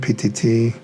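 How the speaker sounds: ordinary talk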